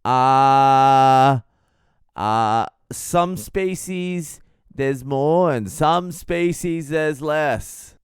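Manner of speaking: loud stretched uhs then high slow answer; booming fumbling stranger; loud hesitant guy. Retold or imitated. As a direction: drag out the uhs loudly. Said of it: "And he goes".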